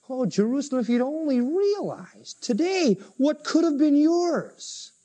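high, drawn-out plea of regret; anguished lament. Acted. Jesus crying over Jerusalem's lost chance, anguished. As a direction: cry out in regret, stretching the words.

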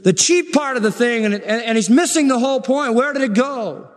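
high, insistent pulpit cry; impassioned preaching. Real, not acted. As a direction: hammer out indignant rhetorical questions. Preaches at full force.

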